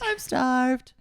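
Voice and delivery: high, loud echo of a silly cry; mock announcement. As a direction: copy the overdone high call. Echoes the joking high cry.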